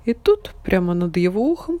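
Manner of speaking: ordinary delivery, nothing marked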